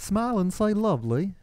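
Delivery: English accent. Shown as a demonstration. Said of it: "Can we do it in an English accent?".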